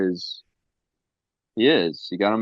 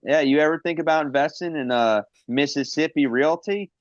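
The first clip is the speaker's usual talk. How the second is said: salesman voice; 8 semitones higher than usual. Pretend. [name] pitching to Andrade.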